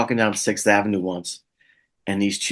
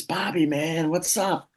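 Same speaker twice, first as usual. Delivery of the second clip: excited fan; 8 semitones higher, slower than usual. Retold or imitated. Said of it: "closer to me says".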